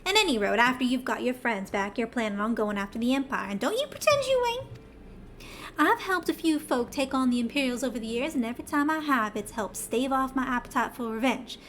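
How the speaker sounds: put-on lightly drawled Southern accent read in for a Cockney character; a Southern accent standing in for Cockney, held lightly; Southern twang. Streamer voicing the bitter old schemer Glommet, Southern accent. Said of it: "the heaviest Southern twang that I can pull off".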